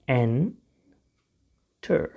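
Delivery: Enter very slowly, split "en... ter"; syllable by syllable. Shown as a demonstration. Teacher shows learners how to pronounce it, slow syllable by syllable. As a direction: stretch enter out with a long gap.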